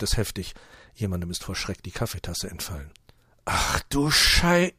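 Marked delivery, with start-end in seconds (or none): 3.4-4.7 blurted shock. Acted. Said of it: "entfährt es Otto".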